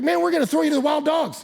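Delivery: high, loud acted warning; exasperated threat. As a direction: threaten him in mock exasperation. Captors threaten Polycarp.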